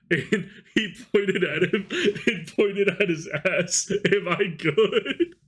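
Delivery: high, slow words broken up by laughter; laughing while talking. Real, not acted. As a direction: crack up while telling the story. Laughing while telling the story.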